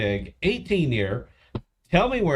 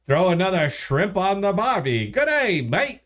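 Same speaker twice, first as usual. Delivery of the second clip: high, exaggerated Aussie twang; hammed-up Australian accent; 5 semitones higher than usual. Shown as a demonstration. Host proving to co-host he can do it, mock Australian accent. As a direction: show off a bad Australian accent. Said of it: "I could do Australian".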